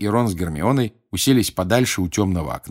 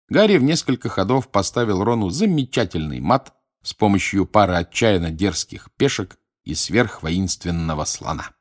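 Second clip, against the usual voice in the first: ordinary delivery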